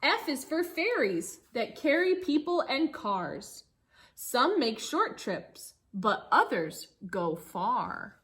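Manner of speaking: lively storybook reading for a class; reading to children. Acted. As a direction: read the rhyme aloud to the kids, bouncy. Teacher reads picture book aloud.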